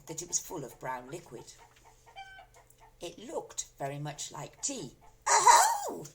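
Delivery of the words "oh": sudden high loud cry. A narrator's reading. Grandma crying out at the cup. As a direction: yelp it out in alarm. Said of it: "she cried".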